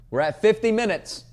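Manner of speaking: high, loud proud announcement; excited loud call. Real, not acted. Host proudly announcing the runtime to listeners, loud and high. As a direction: belt out the milestone, pumped.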